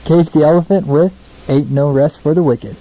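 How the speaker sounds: usual speaking voice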